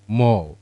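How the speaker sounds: "mo" alone, loud and firm; "mo" as a single loud, falling beat; stressed syllable. Shown as a demonstration. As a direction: hit the syllable MO hard. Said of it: "When we stress that one".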